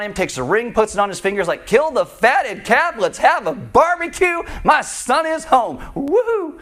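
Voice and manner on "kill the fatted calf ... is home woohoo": high, drawn-out celebratory holler; elated, high-pitched proclamation; jubilant father. Acted. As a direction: announce the party like an overjoyed dad, up to a whoop. Acts overjoyed father announcing party.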